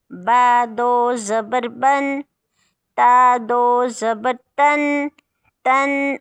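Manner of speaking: बा दो ज़बर बन drawn out very slowly and clearly; slow spelling drill. Shown as a demonstration. Teacher drilling children, slow careful recitation. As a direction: spell each syllable slowly for the class. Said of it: "अब देखें".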